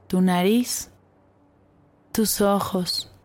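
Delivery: put-on calm guide easing children to relax; guided meditation. Guides a body scan meditation.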